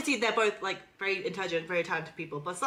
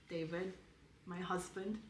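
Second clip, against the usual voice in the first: very soft, earnest American aside; sincere American accent; 4 semitones lower, 14 dB quieter than usual. Acted. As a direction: address a husband softly in put-on American. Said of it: "sincere American accent to David".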